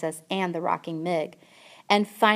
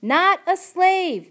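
put-on loud high shout at a half-deaf girl; 12 semitones higher, 8 dB louder, slower than usual. A narrator's reading. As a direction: bellow it so she can hear. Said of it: "shouted the soldier".

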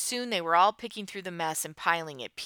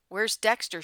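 higher, louder worried question; voice raised a step; 5 semitones higher, 5 dB louder than usual. A narrator's reading. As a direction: ask with rising alarm. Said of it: "Beth's voice went up a step".

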